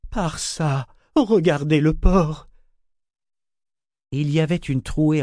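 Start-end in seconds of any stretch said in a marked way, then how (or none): 0.1-2.5 urgent exclamation, louder and higher; drawn-out cry of alarm; exclaiming. A narrator's reading. Ronica points the others to the port, exclaiming. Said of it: "Ronica s'exclama".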